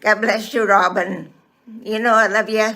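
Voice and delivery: normal talking voice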